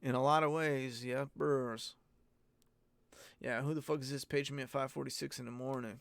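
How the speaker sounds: normal talking voice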